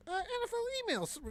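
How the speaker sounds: high, fast Leno impression. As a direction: squeak it out like Leno. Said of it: "I couldn't keep it up".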